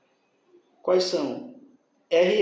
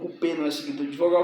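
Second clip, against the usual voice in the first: ordinary delivery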